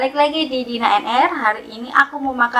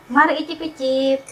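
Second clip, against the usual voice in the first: ordinary talk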